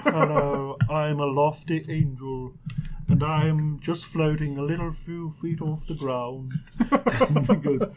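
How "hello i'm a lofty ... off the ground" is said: low, soft, lofty angel. Acted. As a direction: float the words out, low and gentle. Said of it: "I need to come up with an angel voice".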